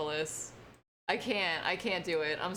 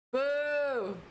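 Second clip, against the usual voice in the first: booing; 7 semitones higher than usual. Real, not acted. Booing the new version.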